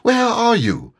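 high, eager calling; eager cry. A narrator's reading. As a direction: call out eagerly for a friend. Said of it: "cried Pooh eagerly".

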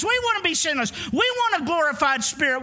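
ordinary talk

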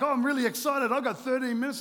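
playing up false excitement over the time left; mock excited. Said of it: "I'm like".

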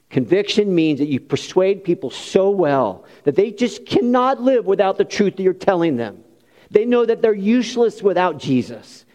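usual speaking voice

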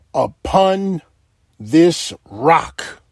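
"Upon this rock" in weighty pieces, pausing before "this rock"; emphasis on rock. Real, not acted. Preacher quoting Christ to the congregation, "rock" heavily emphasized. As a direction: set "rock" apart with heavy, deliberate emphasis. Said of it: "I know I'm putting emphasis on the rock".

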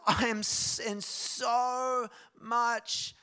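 loud, slow imitation of hurt; retold sobbing outburst. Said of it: "And she's sobbing".

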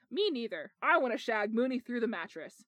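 ordinary delivery

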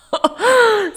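sleepy laugh. Real, not acted. Laughs at her yawning.

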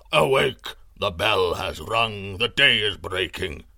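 low, loud, slow summoning voice; deep and commanding, like a herald waking a king; great voice. A narrator's reading. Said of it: "said the voice again".

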